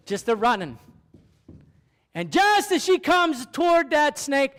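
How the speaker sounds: usual speaking voice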